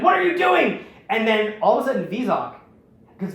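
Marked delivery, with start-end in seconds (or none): 0.0-0.8 high, loud startled cry; shocked yelp. Retold or imitated. Vizok crying out at his blaster, shocked and loud. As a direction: yelp it out, just got blasted. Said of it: "And Vizok is like".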